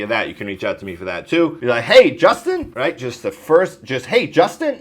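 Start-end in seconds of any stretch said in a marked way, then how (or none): 1.8-2.7 bright, loud, high Hey with a rising lift; enthusiastic greeting. Shown as a demonstration. Agent demoing an upbeat cold-call greeting, excited. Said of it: "it sounds something like this for enthusiasm". 4.0-4.8 bright, loud, high Hey with a rising lift; enthusiastic greeting. Shown as a demonstration. Agent demoing an upbeat cold-call greeting, excited. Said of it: "it sounds something like this for enthusiasm".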